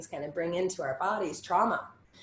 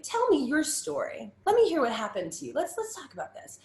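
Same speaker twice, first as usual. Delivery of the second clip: gentle invitation; 9 semitones higher than usual. Retold or imitated. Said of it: "she was the first person that really was like".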